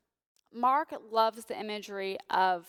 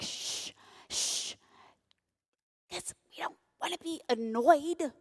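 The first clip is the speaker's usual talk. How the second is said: hushing, then a higher annoyed whine; mock shushing; 6 semitones higher than usual. Pastor imitates churchgoers shushing, higher mock annoyance. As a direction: shush like someone bothered. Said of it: "sometimes we're like".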